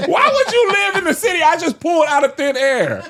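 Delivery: high, loud laughing protest; high laughing outrage. Retold or imitated. Telling friends about the made-up city, high laughing outrage. Laughing retelling of his panic.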